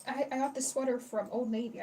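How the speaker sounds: ordinary talk, nothing marked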